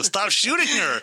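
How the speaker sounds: high-pitched mock scolding; retold exasperated order. Husband telling his wife to hold fire, retold exasperation.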